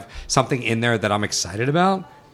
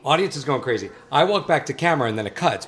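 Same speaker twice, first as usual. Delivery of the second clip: usual speaking voice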